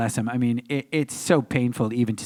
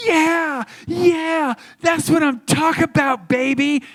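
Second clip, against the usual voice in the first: put-on high, loud triumphant kid cheer; gloating cheer; 4 dB louder than usual. Kid [name] cheering his first win over Zach. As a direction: crow over the win like an excited eleven-year-old.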